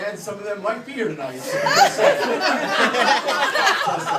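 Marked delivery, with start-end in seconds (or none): 1.4-4.2 laughing. Real, not acted. Comic laughing through punchline.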